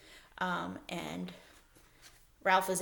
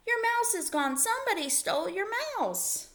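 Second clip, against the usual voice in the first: put-on excited child; 12 semitones higher than usual. A narrator's reading. Lana announces missing mouse.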